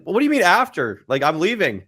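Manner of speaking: high, indignant comeback; mock-exasperated retort; retold exasperation. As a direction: protest in disbelief, pitch jumping up. Acted quarrel with mom retold.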